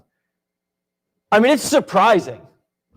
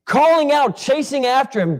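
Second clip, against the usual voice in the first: ordinary talk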